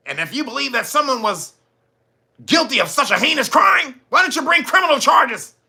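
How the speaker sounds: hammed-up outrage reading the letter; mock emphatic denial. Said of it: "Came out with an emphatic letter".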